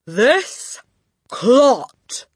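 loud, high boom. Acted. Headmistress booming at the boy. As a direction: boom the insult at him. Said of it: "boomed the headmistress".

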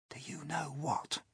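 breathy secretive whisper; a hushed, voiceless whisper. Acted. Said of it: "James whispered".

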